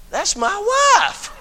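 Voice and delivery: high, slow, hushed disbelief; stunned aside. Retold or imitated. Preacher spotting his wife whooping in the choir, hushed amazement. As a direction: breathe it out like you can't believe it. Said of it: "I had to go like this".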